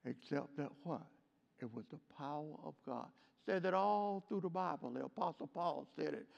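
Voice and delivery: soft intimate preaching. Real, not acted. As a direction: draw them in close, low.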